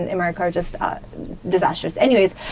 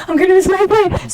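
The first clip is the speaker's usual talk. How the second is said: panicked; 8 semitones higher, 4 dB louder than usual. Retold or imitated. Panicking at the shut gate.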